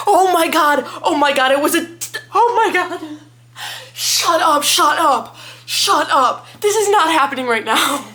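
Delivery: loud, high, stunned disbelief; real shock. Real, not acted. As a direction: burst out as if floored by a plot twist. Reacting to a shocking plot twist.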